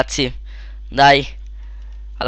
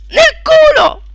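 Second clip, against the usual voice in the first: shriek; 5 dB louder, slower than usual. Real, not acted. Sudden in-game disaster.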